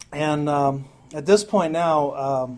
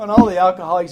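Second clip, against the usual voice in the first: ordinary talk, nothing marked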